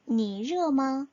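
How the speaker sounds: 니热吗 said at normal speed with clear tones; natural-speed repeat; Chinese at pace. Shown as a demonstration. Teacher repeats the question at normal speed. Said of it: "어떻게 대답해야 한다".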